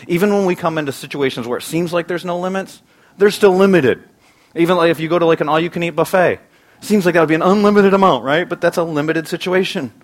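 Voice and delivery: loud, high, driving sermon voice; forceful preaching. Acted. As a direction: build it up for the congregation.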